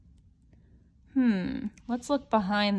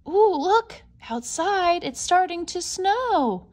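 higher and louder, wonder in every word; excited; 7 semitones higher, 5 dB louder, slower than usual. Acted. Pointing out the snow to a small child, excited. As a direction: gasp with delight at the snow.

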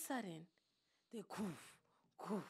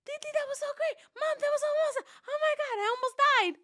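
put-on much higher, louder, fast gushing kids; thrilled kids; 8 dB louder, much faster than usual. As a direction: gush like kids off a wild ride. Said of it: "They're like".

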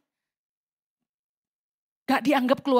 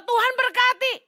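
high, pleading "Tuhan, berkati"; mock begging prayer; 10 semitones higher than usual. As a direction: beg God in a high little voice. (doa merengek yang dibuat-buat)

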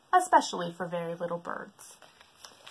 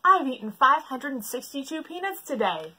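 lightly voiced elephant, higher; proud elephant; 6 semitones higher, 4 dB louder than usual. Elephant tells the sleeping zoo, lightly voiced. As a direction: boast about the peanuts.

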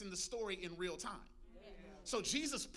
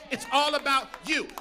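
loud preaching; 3 semitones higher, 10 dB louder than usual. Real, not acted. Preaching climax to Jesus.

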